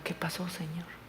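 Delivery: breathless whisper of a grieving father; whispered thought. Retold or imitated. Pastor questioning God in silence, whispered. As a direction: hush it like a private prayer. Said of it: "Iba pensando".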